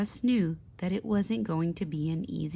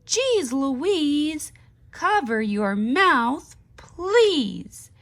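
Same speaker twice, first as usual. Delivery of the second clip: much higher and louder angry shout; not-so-ballerina shout; 7 semitones higher, 7 dB louder, much slower than usual. Acted. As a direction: yell it at Louise, fed up. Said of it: "shouted Amanda in a not-so-ballerina voice".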